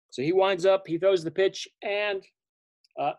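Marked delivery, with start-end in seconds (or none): none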